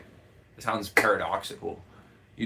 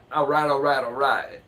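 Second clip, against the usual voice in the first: Matthew McConaughey drawl; 3 semitones higher, 4 dB louder than usual. Retold or imitated. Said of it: "President Matthew McConaughey".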